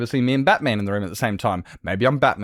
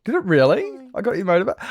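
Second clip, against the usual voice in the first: playful high voice; 13 semitones higher, 5 dB louder than usual. Acted.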